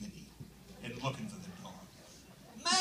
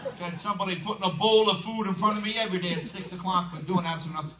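usual speaking voice

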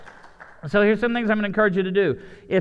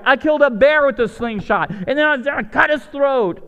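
louder, higher boastful bragging; excited boast; 5 semitones higher, 4 dB louder than usual. Retold or imitated. David convincing the doubtful soldiers, excited boast. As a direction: brag it eagerly. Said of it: "encouraging other people".

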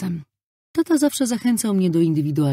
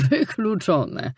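slow, drawn-out theatrical puff; a hint of a wheezing priest; wheezed theatrically; 4 semitones higher, much slower than usual. A narrator's reading. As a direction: puff the word out like a stage priest. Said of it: "wysapał teatralnie".

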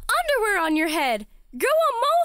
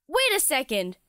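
ordinary talk

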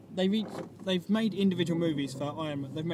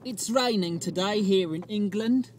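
loud, hammed-up English accent, higher pitched; exaggerated mock-English greeting; fake English accent; 5 semitones higher, 6 dB louder than usual. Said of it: "This is my fake English accent".

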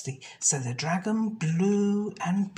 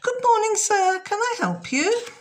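much higher, louder bright shopkeeper greeting; a brave little girl putting on her best manners; polite shop girl; 6 dB louder than usual. Acted. Jenny greets the crocodile customer, high and loud. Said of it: "But she said".

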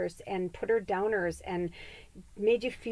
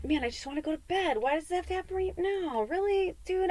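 exasperated whine; 10 semitones higher, 3 dB quieter than usual. Retold or imitated. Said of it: "I'm like".

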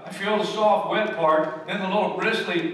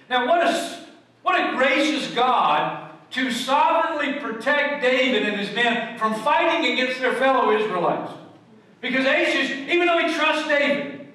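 raised, ringing preacher voice, higher and louder; full-force preaching; 6 semitones higher, 4 dB louder than usual. Acted. Preacher to his congregation, booming and raised. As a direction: proclaim it to the whole congregation. Preacher praising God's protection.

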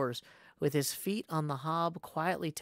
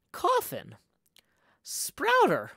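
each name echoed in disbelief, pitch jumping; startled; 8 semitones higher, 5 dB louder than usual. Acted. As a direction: repeat the words as a startled question. Reacts to the sign's words.

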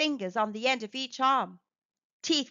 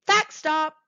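higher and louder announcement; excited callout; 5 semitones higher, 6 dB louder than usual. Acted. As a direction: call it out like a fun game signal. Announcing a fact break heading.

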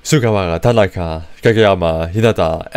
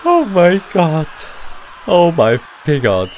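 high, breathless joy; elated; 8 semitones higher than usual. Real, not acted. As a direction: cheer in disbelief. Celebrating the win.

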